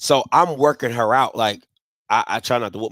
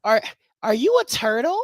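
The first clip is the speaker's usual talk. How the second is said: put-on much higher, slow, syrupy talk-to-a-child voice; much slower than usual. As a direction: coo it like a lady talking to a toddler.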